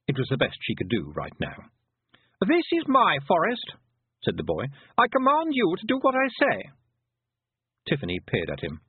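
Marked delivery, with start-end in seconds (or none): 2.4-3.7 put-on high, slow lordly boy voice; imperious boy. A narrator's reading. Haughty boy claims the forest. 5.0-6.7 put-on very high, commanding child voice; imperious boy. A narrator's reading. Haughty boy gives an order.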